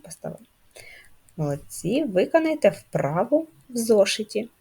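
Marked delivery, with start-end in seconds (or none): none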